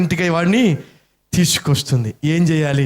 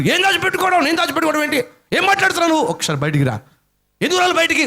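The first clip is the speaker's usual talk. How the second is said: indignant denial; 13 semitones higher, much faster than usual. Acted. Thief protests innocence.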